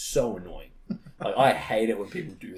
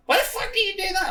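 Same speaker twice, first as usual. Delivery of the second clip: put-on very high, louder child outrage; squeaky mock-outraged gamer child; twelve-year-old kid; 6 dB louder than usual. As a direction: imitate an indignant kid protesting. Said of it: "this little fucking twelve-year-old".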